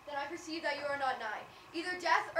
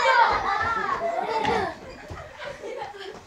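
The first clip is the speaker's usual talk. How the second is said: breathless cry; 7 semitones higher, 12 dB louder than usual. Acted. Helena after the chase.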